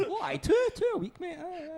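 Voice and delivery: high, breezy fibbing Scottish voice; fibbing drunk. Retold or imitated. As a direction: wave it off like an innocent liar. Lying to doctor about drinking.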